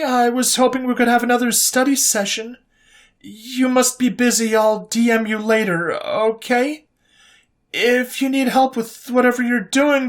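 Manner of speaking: high, halting, pleading voice; nervous pleading messages to Coleman; needy, desperate messages. A narrator's reading. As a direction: read the texts as an insecure, clingy girl. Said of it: "how disgustingly desperate and needy the messages".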